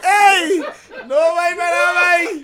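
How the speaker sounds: high, very loud outcry; shouted protest. Real, not acted. Objecting to his friends' teasing, high shouted protest. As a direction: shout it out over the laughter.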